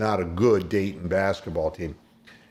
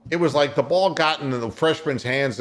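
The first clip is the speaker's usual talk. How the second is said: normal talking voice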